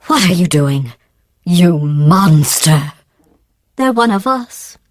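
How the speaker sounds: feigned outrage, drawing out the accusation; mock horror. AI scolds test subjects as a joke, mock horror. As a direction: act appalled, as if genuinely shocked.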